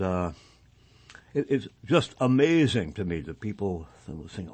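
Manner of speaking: ordinary talk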